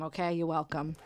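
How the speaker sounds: usual speaking voice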